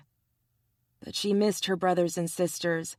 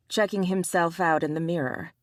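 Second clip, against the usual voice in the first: plain reading voice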